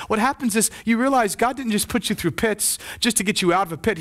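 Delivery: ordinary delivery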